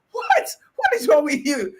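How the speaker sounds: high, loud squeal of disbelief; incredulous outburst. Acted. Speaker scolding slim men with pot bellies, incredulous outburst. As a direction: shoot the pitch right up, appalled and exasperated.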